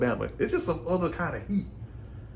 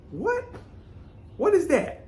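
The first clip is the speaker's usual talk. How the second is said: much higher, incredulous what; shocked disbelief. Retold or imitated. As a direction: react as if you can't believe it. Reacting to being told go to hell.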